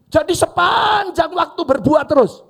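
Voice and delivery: a sudden high, loud burst; preaching shout. Real, not acted. Preacher shouting to the congregation, loud emphatic burst. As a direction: shout it out to drive the point home. Preacher stressing fig tree fruit.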